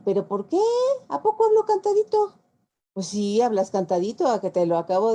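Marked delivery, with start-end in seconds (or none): 0.0-2.3 high, loud, hammed-up disbelief; mock indignant surprise. Mexicans told they talk singsong, in loud mock disbelief. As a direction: play it as a shocked mock protest, way up high. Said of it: "Y entonces nosotros decimos".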